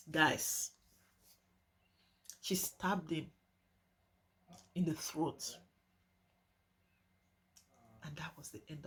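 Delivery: soft and halting, with long pauses; hushed, grave telling. Real, not acted. Telling viewers she killed her fiancé, hushed and grave. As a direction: let it sink in, very quietly. Grave climax of a killing story.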